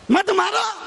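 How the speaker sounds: high cry to stop, imitating the saint; acted urgent command. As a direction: call out to halt a beating. Saint's plea repeated, raised. (ऊँची आवाज़ में रोकने की पुकार)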